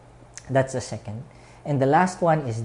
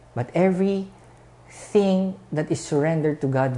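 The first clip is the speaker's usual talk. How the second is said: usual speaking voice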